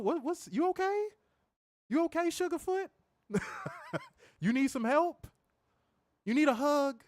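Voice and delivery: high, sing-song mock sympathy; mock concern. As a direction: coo at the chat user like a baby. Mocking a chat user.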